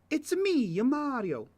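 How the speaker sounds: put-on high Italian Mario accent. Viewer asked for the Mario accent, high Italian Mario voice. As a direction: sing it out like Mario. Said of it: "Or like".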